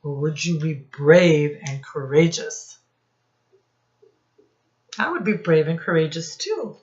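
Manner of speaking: plain reading voice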